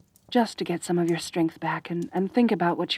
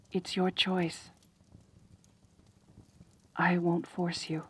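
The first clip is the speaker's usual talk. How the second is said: soft reassurance; 2 semitones lower, 6 dB quieter than usual. Acted. Promises the guest freedom, gently.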